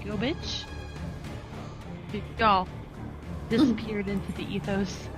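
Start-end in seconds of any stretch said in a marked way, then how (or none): none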